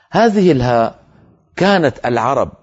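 ordinary talk

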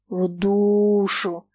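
two words stretched out in contempt; scornful drawl. A narrator's reading. Foma throws Sasha's words back at her, contemptuously. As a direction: drag the words out slowly, disdainfully. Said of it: "презрительно протянул Фома".

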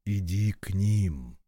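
low, slow and tender; a father's soft command; gentle merciful voice. Acted. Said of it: "мягко прозвучал над ним тот же милостивый голос". (мягкий милостивый голос)

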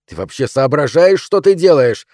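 loud, high angry attack; lashing out. A narrator's reading. Husband rounding on his wife, lashing out. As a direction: go for her at full volume. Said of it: "набросился на неё Джеймс".

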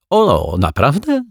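high, drawn-out delighted question; giggly delight. A narrator's reading. Altar boy thrilled the detective agreed, giggly. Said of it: "ministrant zachichotał".

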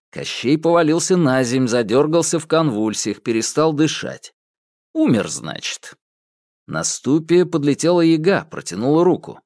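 plain reading voice

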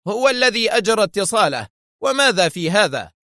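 high, testy retort; nervy irritation. A narrator's reading. Director defending the President's call, irritated. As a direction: fire back defensively, on edge. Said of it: "لوح المدير بذراعيه قائلا في عصبية".

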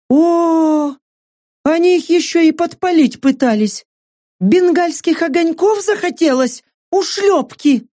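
outraged mercenary woman. Acted. Saka outraged.

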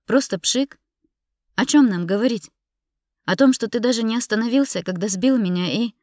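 high, loud, indignant questions; heated accusation. Acted. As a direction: fire accusing questions, then break off. Wife hurls accusations at husband.

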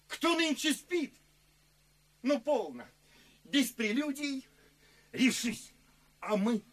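loud eager coaxing; excited urging. Acted. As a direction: pull him along, full of fervour. Urging friend not to sleep.

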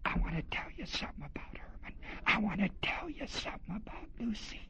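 fast hushed whisper; gossipy whisper. Retold or imitated. Murmuring crowd gossiping behind Jesus' back, fast whisper. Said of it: "they didn't say it out loud".

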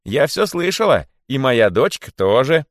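high, loud announcement; monkey's shout. A narrator's reading. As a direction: call it out to the crowd. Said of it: "закричала она". (крик мартышки сверху)

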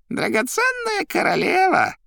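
very high, slow, loud squeak; Koroviev squeaking. A narrator's reading. Koroviev addressing his queen, high squeaky voice. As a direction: squeak it out, drawn out. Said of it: "пищал Коровьев".

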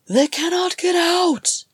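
high, loud scream of terror; terrified scream. Acted. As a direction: scream it out in panic. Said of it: "screamed a Drero named Kulin".

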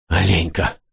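plain reading voice

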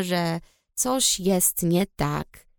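usual speaking voice

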